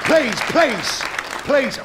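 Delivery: loud pleading to a laughing room; pleading loudly. Real, not acted. Comic begs the laughing pub crowd, loudly. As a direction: beg the crowd to calm down. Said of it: "begging audience to settle".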